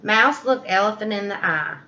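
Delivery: usual speaking voice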